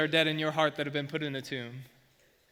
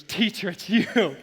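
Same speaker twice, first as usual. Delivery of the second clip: higher, louder, slow overjoyed cry; joyful recognition; 5 semitones higher, 7 dB louder, slower than usual. Acted. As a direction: burst out in joyous recognition. Mary recognizes risen Jesus.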